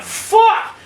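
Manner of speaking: high, pinned-down mock cry; retold alarmed yell. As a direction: yelp the curse in alarm.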